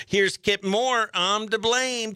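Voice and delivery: ordinary talk, nothing marked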